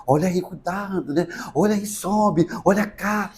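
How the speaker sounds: imitating himself fussing softly, pitched above his murmured narration; soft, tiptoeing little warnings; over-careful guiding. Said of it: "eu cheio de dedo com a mulher". (cheio de dedos, baixinho)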